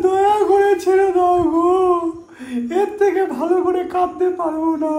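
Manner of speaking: hammed-up wailing plea, high and slow; fake crying. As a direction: whimper it like a badly faked cry. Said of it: "কি করে কাঁদবো".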